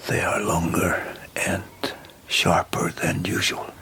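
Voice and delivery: whispered discovery; doctor whispering about the fangs; fearful whisper. Acted. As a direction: breathe it in dread. Noticing Lucy's teeth.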